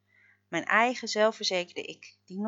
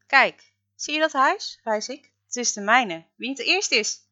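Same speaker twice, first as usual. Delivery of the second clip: higher, louder playful challenge; excited call; 5 semitones higher, 8 dB louder than usual. A narrator's reading. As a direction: dare him to race home. Challenges him to a race.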